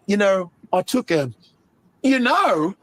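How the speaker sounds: put-on British accent; British actor. Comedian imitating a British actor talking off screen, British accent. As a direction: slip into a British interview voice. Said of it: "and he's like".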